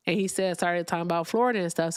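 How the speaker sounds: ordinary talk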